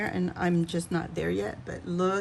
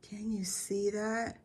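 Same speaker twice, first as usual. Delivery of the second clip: soft unvoiced whisper; whispered aside to viewers, leaning in; 7 dB quieter than usual. Real, not acted. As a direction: hush as if sharing a secret. Close look at painted details.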